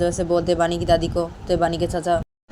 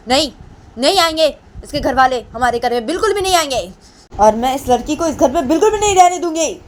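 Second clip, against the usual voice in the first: put-on high, loud scolding old woman's voice; angry grandmother; 8 semitones higher, 4 dB louder than usual. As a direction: shriek it like a furious old grandmother. Grandmother scolds son, high shrill. (गुस्से में चिल्लाती दादी की आवाज़)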